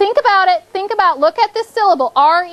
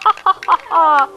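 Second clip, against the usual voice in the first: deliberate, separated ha-ha-ha; teasing mock laugh. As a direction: gloat playfully at jumping the gun. Teasing pupils who answered too early.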